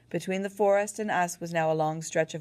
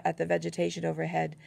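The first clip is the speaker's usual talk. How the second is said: usual speaking voice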